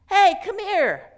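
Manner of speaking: sharp loud call across the train car. Acted. Elderly man calls the drunk over, loud call. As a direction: snap the drunk's attention with a loud hey.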